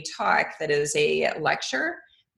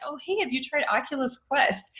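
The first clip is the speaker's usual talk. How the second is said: higher-pitched casual pitch to a nephew; lightly acted enthusiasm, lifted voice; eager retold invitation; 8 semitones higher than usual. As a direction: pitch it up, keen and friendly. Retelling own words to nephew.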